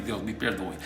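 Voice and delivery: low, soft little prayer; retold apology to God. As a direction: quietly ask forgiveness, as retold. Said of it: "até pedi perdão a Deus". (pedido de perdão em voz baixa)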